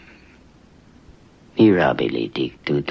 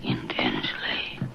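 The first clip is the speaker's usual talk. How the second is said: whisper; 3 semitones lower, 9 dB quieter than usual. Acted. Demon answers the priest.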